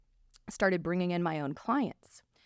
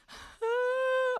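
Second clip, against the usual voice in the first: a long high breathy sigh of heartbreak; heavy retold sigh. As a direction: sigh as if too upset to speak. Telling professor she can't talk.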